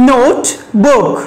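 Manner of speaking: "Note, book" as two separate units; syllable by syllable. Shown as a demonstration. Teacher shows two syllables to students, "Note, book" split apart. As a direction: clear gap between "Note" and "book". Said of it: "There are two syllables here".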